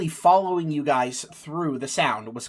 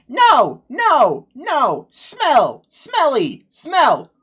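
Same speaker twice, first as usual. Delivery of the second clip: loud, high screechy bird voice; screeching bird; 10 semitones higher, 9 dB louder, much slower than usual. Acted. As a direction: screech each word in disgust like a bird. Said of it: "it screeches 'cause it's like".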